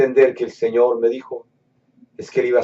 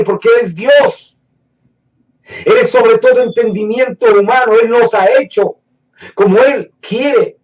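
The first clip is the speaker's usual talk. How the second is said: rising preacher's fervour, louder and higher; preaching; 8 semitones higher, 5 dB louder than usual. Acted. Preacher stirring the congregation, fervent and louder. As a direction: drive each line home to the congregation.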